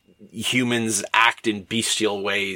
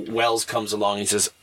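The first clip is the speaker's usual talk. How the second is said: ordinary talk, nothing marked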